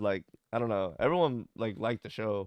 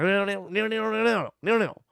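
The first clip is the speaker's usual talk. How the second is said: thick Southern drawl mock; 10 semitones higher, 6 dB louder than usual. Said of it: "they talk like".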